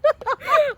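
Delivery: squealing laughter; high laughing fit. Real, not acted. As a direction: burst out laughing. Laughs hard after a long pause.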